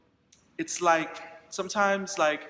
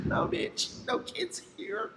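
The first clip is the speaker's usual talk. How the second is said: laughing; 10 semitones higher, 8 dB quieter, slower than usual. Real, not acted. Laughing at dad's wrong guess.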